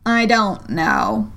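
slow, contrary caterpillar. A narrator's reading. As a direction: drawl it out. Caterpillar voiced in story.

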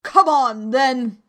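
forceful imperious bellow; roared order. A narrator's reading. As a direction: roar it impatiently as a queen. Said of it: "roared the queen".

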